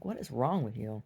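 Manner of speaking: normal talking voice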